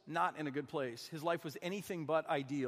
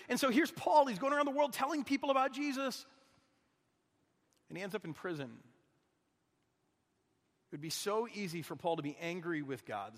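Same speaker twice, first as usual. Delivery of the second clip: ordinary delivery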